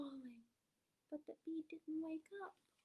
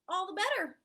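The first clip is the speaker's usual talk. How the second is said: groundhog; 5 semitones higher, 10 dB louder than usual. Acted. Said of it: "said the groundhog".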